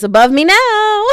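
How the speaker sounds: loud, high over-the-top proclamation; mock-dramatic wail of surrender; dramatic declaration. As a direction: belt it out high, playing it up. Exaggerated dramatic letting-go declaration.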